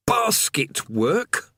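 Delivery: high, slow, put-upon prisoner voice; a tired old convict grumbling on; weary chained man. Acted. Chained man, eyes narrowed.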